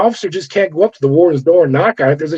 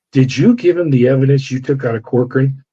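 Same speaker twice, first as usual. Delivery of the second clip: ordinary talk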